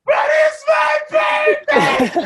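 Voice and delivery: very high, loud, stretched-out wail; hammed-up slow shriek; outward wail. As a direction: let the same tantrum out at full volume. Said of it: "On the outside, I'm like".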